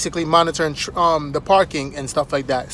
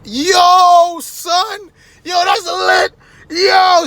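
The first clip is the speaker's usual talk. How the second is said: high, far-off excited shouts; mock hollering with glee; hyped-up attendant; 12 semitones higher, 3 dB louder, much slower than usual. Attendant goes crazy over the feature, high excited shouting.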